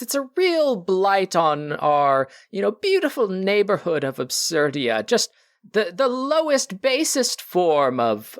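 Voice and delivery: high, drawn-out stuffed-shirt voice; snobbish rival Alistair. Acted. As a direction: disdain for lowbrow taste, slow and high.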